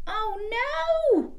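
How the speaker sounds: high alarmed cry. Acted. Reading to children, high mock alarm. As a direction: gasp it out in dismay. Dog falls to pieces.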